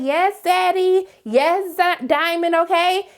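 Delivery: high, loud, drawn-out cheer; a slow, high, loud yes daddy cheer; cheering him on. Retold or imitated. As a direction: egg on the fighter with glee. Cheering on fight while watching.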